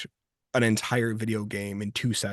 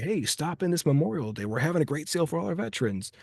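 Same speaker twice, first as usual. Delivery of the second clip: higher, peppy commercial pitch; mock TV ad read, brisk and higher; car dealership ad; 5 semitones higher, faster than usual. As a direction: sell it like a local dealership spot. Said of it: "it's very much like".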